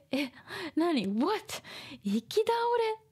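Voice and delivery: sudden startled "what?"; shocked. Acted. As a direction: gasp it out in shock. Acting shocked discovery again.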